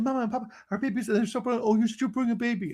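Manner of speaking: very high, fast doting squeal; hammed-up proud parent; gushing parent. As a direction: rattle it off in giddy delight. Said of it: "I said".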